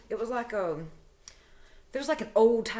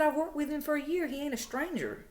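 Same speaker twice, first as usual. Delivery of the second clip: retold defensive protest; 7 semitones higher than usual. Retold argument with girlfriend.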